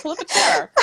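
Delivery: usual speaking voice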